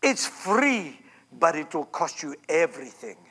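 imitating her theatrical whisper-preach; Kathryn Kuhlman's manner. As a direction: sink low and soft like a dramatic healer. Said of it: "she'd say things like".

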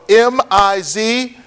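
slow letter-by-letter spelling; measured letters with small pauses between groups. Shown as a demonstration. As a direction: spell it slowly for note-takers. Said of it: "It is spelled".

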